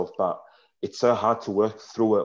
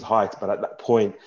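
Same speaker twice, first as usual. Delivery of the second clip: ordinary delivery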